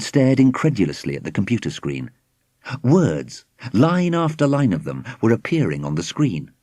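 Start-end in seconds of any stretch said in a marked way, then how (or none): none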